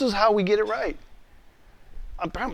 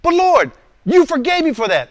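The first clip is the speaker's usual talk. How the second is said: loud, high-pitched pleading excuse; acted indignant objection; protesting; 11 semitones higher, 5 dB louder than usual. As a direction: argue back at God, high and loud. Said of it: "listen to my responses".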